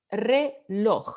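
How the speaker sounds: "Reloj" split into re, LOJ; syllable by syllable. Shown as a demonstration. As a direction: break "Reloj" into two beats, stressing the final LOJ. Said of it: "Última sílaba".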